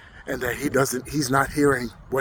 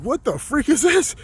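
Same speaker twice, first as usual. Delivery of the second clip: bewildered reaction; 10 semitones higher than usual. Retold or imitated. Telling listeners about faces in the tile, bewildered. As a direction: retell a baffled reaction in a higher voice.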